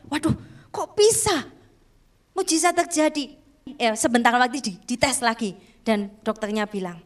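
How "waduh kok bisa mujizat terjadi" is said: astonished father. Retold or imitated. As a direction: act out a stunned father, pitch lifted. Retelling father's amazement at test result.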